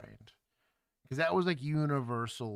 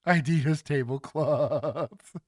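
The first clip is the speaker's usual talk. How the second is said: slow and louder, laughing through it; 4 semitones higher, 9 dB louder, slower than usual. Real, not acted. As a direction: laugh it out at the joke. Streamer laughs at a chat comment.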